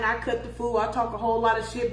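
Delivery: normal talking voice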